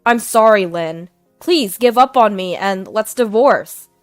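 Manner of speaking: loud, confident declaration; husband confidently choosing mistress, loud. Acted. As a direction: announce it with certainty. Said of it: "Julian confidently said".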